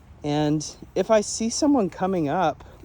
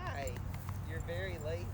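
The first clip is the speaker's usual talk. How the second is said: ordinary talk, nothing marked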